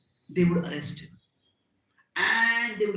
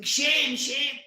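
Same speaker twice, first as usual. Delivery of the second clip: high, raised cry of condemnation; crowd screaming; 8 semitones higher, 5 dB louder than usual. A narrator's reading. Everyone aghast at the killing, crowd screaming. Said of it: "Everyone started screaming".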